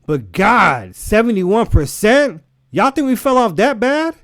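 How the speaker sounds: can't believe the odds; indignant disbelief. Real, not acted. Outraged at the odds.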